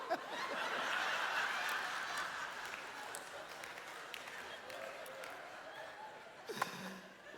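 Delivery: breathless squeaky laugh; high laugh. Real, not acted. Big laugh after punchline.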